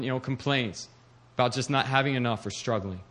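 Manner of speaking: ordinary talk, nothing marked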